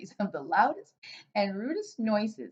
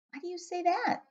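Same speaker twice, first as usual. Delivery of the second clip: put-on higher, curious young girl; little girl voice; 10 semitones higher than usual. Sophie asking the giant, light girl voice. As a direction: ask like a puzzled small girl.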